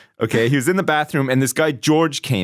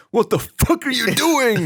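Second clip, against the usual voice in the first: angry principal; 9 semitones higher than usual. Retold or imitated. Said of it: "principal had to come into our class and be like".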